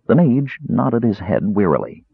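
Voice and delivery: plain reading voice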